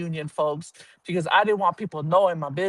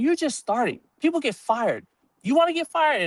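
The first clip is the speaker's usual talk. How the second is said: scolding warden; 8 semitones higher than usual. Retold or imitated. Warden scolding new employee.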